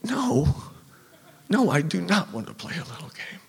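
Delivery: high, pleading frightened voice; panicked thought. Retold or imitated. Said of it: "And I think".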